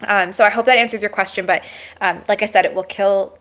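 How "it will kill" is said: ordinary talk, nothing marked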